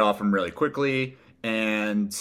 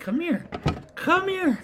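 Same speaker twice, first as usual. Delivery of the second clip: high sing-song dog voice; talking to a dog; slower than usual. Real, not acted. Calling dog, very high pitch.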